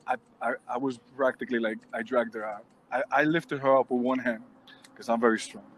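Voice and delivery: ordinary talk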